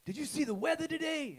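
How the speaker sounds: very high-pitched New Orleans voice; mock New Orleans small talk, way up high; Shorty's New Orleans accent. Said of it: "Shorty was from New Orleans".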